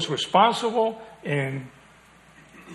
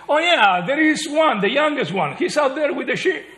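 offhand remembering father; 10 semitones higher, 5 dB louder than usual. Retold or imitated. Jesse voiced remembering David.

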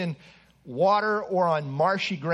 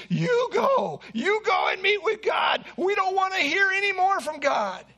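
frightened shout; 11 semitones higher than usual. Retold or imitated. Israelites at Sinai begging Moses to go instead, frightened shouting. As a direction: shout it in panic, pushing Moses forward.

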